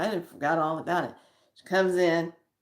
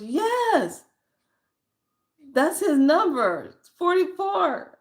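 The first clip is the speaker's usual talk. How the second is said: much higher and louder, excited yes; an excited client who is sure of it; excited client confirming; 11 semitones higher, 5 dB louder, slower than usual. Retold or imitated. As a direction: voice the client's thrilled confirmation, pitched way up.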